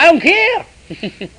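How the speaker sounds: hammed-up carefree voice of the new believer; mock carefree quote. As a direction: play the happy convert who shrugs it off. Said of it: "He said".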